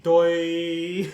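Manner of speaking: loud, drawn-out doofus 'doi'; mock duh. Girl points at her forehead, loud mock duh. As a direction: blurt it as a dopey taunt. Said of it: "pointed at her forehead and went".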